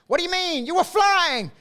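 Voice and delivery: put-on over the top Jersey boy. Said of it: "over the top, um, Jersey boy".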